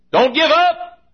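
high, loud rallying cry; shouted exhortation. Real, not acted. Preacher urging church not to give up on parents, shouted plea. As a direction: shout it to spur them on.

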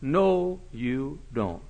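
ordinary talk, nothing marked

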